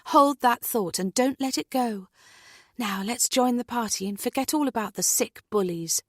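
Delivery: cheerful, encouraging woman; brisk party invitation; upbeat friend. Acted. Natalie upbeat.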